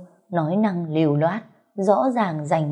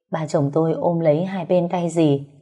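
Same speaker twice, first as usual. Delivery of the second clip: usual speaking voice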